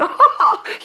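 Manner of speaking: high, loud cackling laugh of a spoiled sister; mocking laugh. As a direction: laugh right in her face. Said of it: "Bertha laughed out loud".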